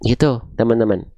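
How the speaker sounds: ordinary delivery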